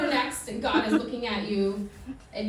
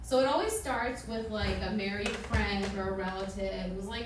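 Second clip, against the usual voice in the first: usual speaking voice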